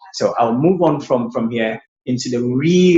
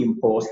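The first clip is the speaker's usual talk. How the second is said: imports with IM stressed, first syllable high; noun stress; 4 semitones lower, 4 dB quieter than usual. Shown as a demonstration. Teacher naming the rice as a noun, first-syllable stress on imports. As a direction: punch the IM of imports. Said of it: "first syllable high".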